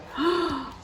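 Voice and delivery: soft shocked gasp; a little gasp of dismay. Acted. Storyteller gasps at Thor's failure, softly. Dramatic gasp at Thor failing.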